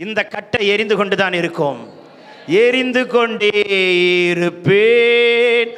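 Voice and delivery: loud, impassioned declaration to a congregation; fiery sermon climax; full-force preaching. Real, not acted. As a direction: preach it out, stretching the last words loud and long.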